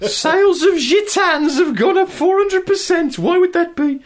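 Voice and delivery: very high, loud put-on voice; comic high-pitched character wondering aloud; puzzled local shopkeeper. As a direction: squeal it like a baffled village newsagent. Acted local shop puzzled by sales.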